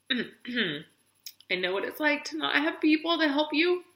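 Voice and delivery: high, slow words squeezed out through tears; telling viewers she knows the bad place, crying. Real, not acted. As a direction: choke the words out while crying. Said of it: "I'm not always this emotional".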